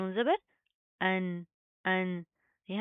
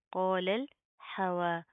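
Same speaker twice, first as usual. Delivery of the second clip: slow chanted tajweed, heavy qaaf; Quranic recitation; much slower than usual. Shown as a demonstration. Teacher recites the verse for students to copy. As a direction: chant क़ालल slowly with a thick qaaf. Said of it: "recitation demo".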